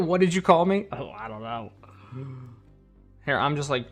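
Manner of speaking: normal talking voice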